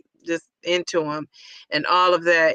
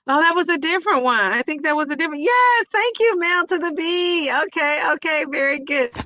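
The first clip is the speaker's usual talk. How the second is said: excited realization; 8 semitones higher than usual. Real, not acted. Realizes she misread, thanks viewer.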